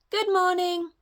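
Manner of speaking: high cheerful call; high girl calling to rabbits and deer. Acted. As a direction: call out to the animals. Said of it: "she called".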